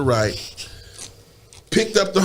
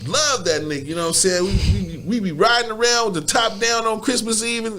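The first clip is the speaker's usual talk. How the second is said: ordinary delivery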